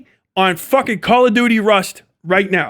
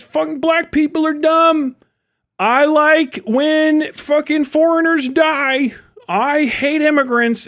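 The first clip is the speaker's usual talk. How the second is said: higher, slow mocking impression of a blunt streamer; mock Asmongold quote; 6 semitones higher, slower than usual. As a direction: ham it up as the careless provocateur.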